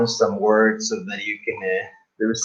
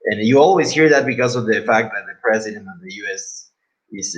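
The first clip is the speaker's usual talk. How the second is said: usual speaking voice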